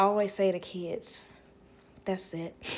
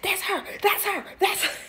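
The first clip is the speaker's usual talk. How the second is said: much higher, eager repeating; excited pointing; 10 semitones higher than usual. Retold or imitated. The woman excitedly pointing her out to her husband.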